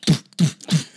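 loud thudding doof noises; loud mock crash sounds; imitated thuds. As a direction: punch out each doof like a car hitting barrels. Imitating hitting barrels again.

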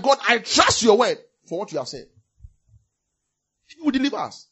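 loud, high preacher's exhortation; fiery preaching. Acted. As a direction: rouse the congregation. Preaching at full force.

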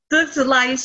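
ordinary talk

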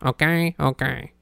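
lightly voiced Italian Squidward; robot gone wrong into Italian Squidward; bad Italian Squidward. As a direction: do a poor Squidward with an Italian lilt. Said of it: "a bad fucking Squidward, uh, Italian Squidward".

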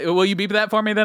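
normal talking voice

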